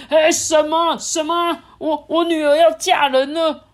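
high loud alarmed cry; shocked king. Acted. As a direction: panic that the daughter will marry. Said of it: "国王就说".

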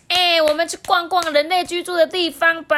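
high, loud, eager frog voice; clap-happy little frog suggesting an outing; excited frog. Acted. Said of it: "拍着手说".

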